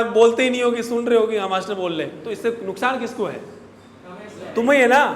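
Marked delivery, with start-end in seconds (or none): 4.5-5.0 louder, higher pointed rebuke; raised scolding voice; exasperated rebuke. Real, not acted. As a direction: drive it home to the students. Teacher rebukes class.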